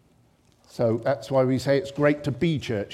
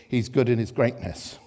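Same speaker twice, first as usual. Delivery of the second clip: usual speaking voice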